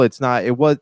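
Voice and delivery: ordinary delivery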